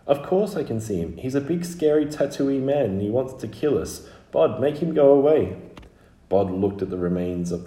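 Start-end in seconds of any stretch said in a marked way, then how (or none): 0.1-5.6 put-on high-pitched child pleading for help; a scared child's urgent, higher voice; frightened little Scarlet.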